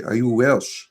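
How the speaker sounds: ordinary talk, nothing marked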